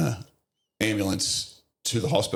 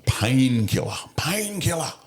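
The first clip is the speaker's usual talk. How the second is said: imitated pained scream; 6 semitones higher, slower than usual. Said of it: "He's screaming at me".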